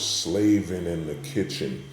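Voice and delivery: normal talking voice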